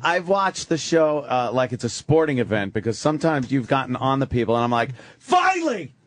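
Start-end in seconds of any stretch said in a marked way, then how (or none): 5.2-5.9 high-pitched exclamation, as if yelling at the screen; mock yell of exasperated relief at the TV; imitated TV yell. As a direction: cry out high, like a fan watching sport. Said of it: "I'm, like, yelling at the T V".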